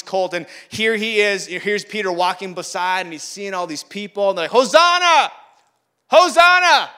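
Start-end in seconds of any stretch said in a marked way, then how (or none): 4.5-6.9 high, loud cry of acclaim; crowd's shout. Acted. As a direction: belt it out like a cheering crowd. Crowd crying out to Jesus.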